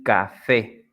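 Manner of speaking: "café" slowly as ca-FÉ, rising at the end; stress on last syllable. Shown as a demonstration. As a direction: separate "café" and lift fé. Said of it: "like in the examples".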